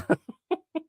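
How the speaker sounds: very high squeaky giggle; squeaky laugh. Real, not acted. Still laughing at the naked-viewer joke, squeaky giggle.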